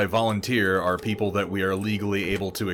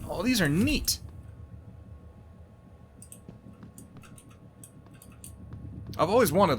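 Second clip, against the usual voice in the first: much higher, delighted; excited; 11 semitones higher, 3 dB quieter than usual. Real, not acted. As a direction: gush over the beds. Finding the bunk beds.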